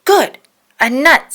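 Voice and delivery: high, loud happy find; delighted. A narrator's reading. Chip thrilled at the fake nut, high and loud. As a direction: glee at the free supper.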